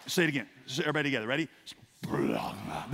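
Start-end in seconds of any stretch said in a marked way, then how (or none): 2.0-2.9 "splagma" very low, dragged up from the belly; from the gut. Shown as a demonstration. Preacher leading everybody together, deep gut-level "splagma". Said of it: "it's gotta come from right here".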